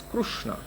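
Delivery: Krishna softly with a kru-sound; Orissa Krishna. Shown as a demonstration. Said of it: "the re is ru".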